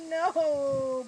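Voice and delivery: loud, drawn-out wail of "no"; anguished cry. Acted. Boyd answers his coach about leaving Arnold, anguished. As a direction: wail it out in misery.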